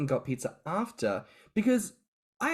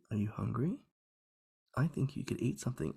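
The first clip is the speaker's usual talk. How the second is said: much softer, hushed suggestion; 8 dB quieter, faster than usual. Retold or imitated. Host imitating Brian's soft suggestion voice, hushed and soft. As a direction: breathe it like a gentle whisper in the head. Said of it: "you can almost hear that soft voice going".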